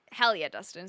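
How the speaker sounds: normal talking voice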